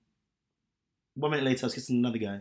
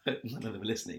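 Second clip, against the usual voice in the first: laughing; 3 semitones lower, 8 dB quieter, faster than usual. Real, not acted. Laughing joke.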